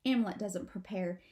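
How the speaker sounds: ordinary delivery, nothing marked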